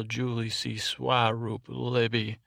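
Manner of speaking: normal talking voice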